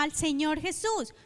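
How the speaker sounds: ordinary talk, nothing marked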